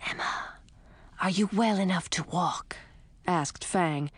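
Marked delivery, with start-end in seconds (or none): 0.1-2.8 hissing snake whisper. A narrator's reading. Fang the snake's voice.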